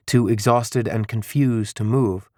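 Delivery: ordinary delivery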